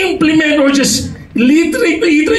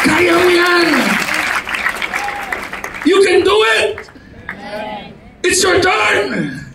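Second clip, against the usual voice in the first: rising encouragement; 4 semitones higher, 3 dB quieter than usual. Shown as a demonstration. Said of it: "another term na sinasabing".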